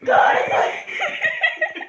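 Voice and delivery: very high, slow breathy whisper; a killer hissing someone to sleep, slowly; creepy whisper. Acted. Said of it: "gonna do a random Nina the Killer impression".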